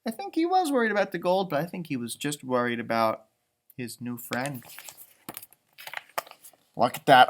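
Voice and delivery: plain reading voice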